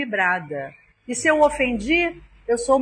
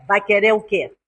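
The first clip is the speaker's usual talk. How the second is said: put-on curt, louder brusque counter girl; rude clerk; 3 semitones lower, 6 dB louder than usual. Bakery clerk to customer, curt rude question. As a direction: snap it out impatiently, mock rudeness. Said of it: "não vou deixar ninguém falar comigo assim".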